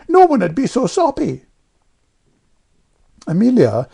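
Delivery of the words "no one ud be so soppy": put-on high, loud stubborn child; Jane contradicting. A narrator's reading. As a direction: retort flat-out as a defiant girl. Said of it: "returned Jane unexpectedly".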